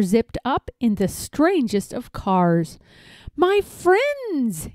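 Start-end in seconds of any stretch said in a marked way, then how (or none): 3.4-4.7 lightly voiced salesman greeting, ringing high; a hint of a slick showman; mild showman call; clear and keen. A narrator's reading. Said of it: "he announced in a voice clear and keen".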